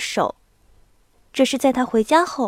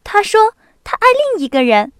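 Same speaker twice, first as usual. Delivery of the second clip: 兴奋的少女; 10 semitones higher, 6 dB louder than usual. A narrator's reading. 娜达莎转述秘密.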